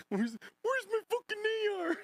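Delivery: high squealing impression; mock high yell. Streamer imitating the clip guy, high squeal.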